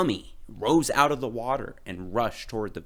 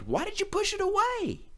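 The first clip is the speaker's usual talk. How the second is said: crying out. A narrator's reading. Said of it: "they cried".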